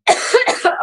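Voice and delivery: loud cough, still choking. Real, not acted. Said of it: "not at you choking".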